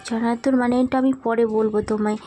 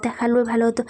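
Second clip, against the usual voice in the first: usual speaking voice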